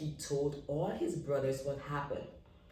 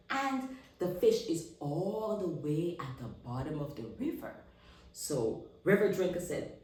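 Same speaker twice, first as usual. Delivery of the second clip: plain reading voice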